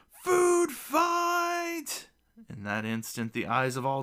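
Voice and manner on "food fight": high, loud, drawn-out yell; bellowed cry. A narrator's reading. Gnome starts a banquet food fight, yelling. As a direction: let out a huge high-pitched battle cry. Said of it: "takes in a deep breath and lets out a".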